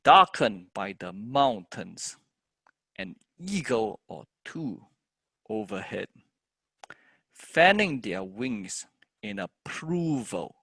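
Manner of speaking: slow, measured verse with the content words drawn out; stressed poem reading. Shown as a demonstration. Teacher reading the whole poem with stresses marked, slow recital. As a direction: recite slowly, leaning on each stressed word. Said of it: "the whole thing will be".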